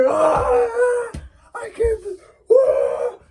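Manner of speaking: very high crying scream; enraged monster shrieking over the trick. Acted. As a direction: shriek in furious despair. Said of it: "He was screaming".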